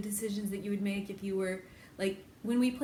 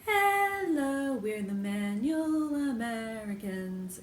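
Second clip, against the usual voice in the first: show intro voice; 2 semitones higher, 3 dB louder, much slower than usual. Shown as a demonstration. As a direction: announce it like a show host. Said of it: "but we'll say".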